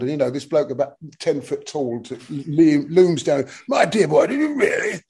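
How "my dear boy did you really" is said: a hint of a posh gentleman. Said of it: "but very, very posh".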